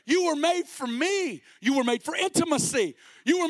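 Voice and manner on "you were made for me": slow, high, declaring voice; Jesus speaking. Retold or imitated. Preacher voicing Jesus to the people, slow and high. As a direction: let each word land, high and slow. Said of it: "He says".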